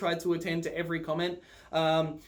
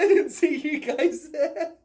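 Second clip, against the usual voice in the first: higher, slow, laughing through it; laughing confession; 8 semitones higher, 5 dB louder, slower than usual. Retold or imitated. Admitting to friends she came on a holiday, laughing. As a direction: giggle while admitting it.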